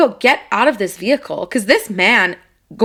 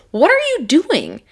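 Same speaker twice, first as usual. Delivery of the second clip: higher, sharp mock scolding through the window; retold angry confrontation; 7 semitones higher, 3 dB louder than usual. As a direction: scold him again, pitched up and heated. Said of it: "I roll my window down and I'm like".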